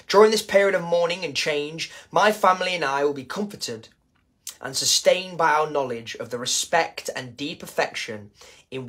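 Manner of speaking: formal statement read. Acted. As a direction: read it out like an official announcement. Reads the King's statement aloud.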